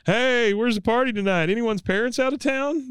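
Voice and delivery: trying way too hard to be cool; high eager Bill. Retold or imitated. Bill to the students, in a high, eager voice. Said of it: "Bill comes up and goes".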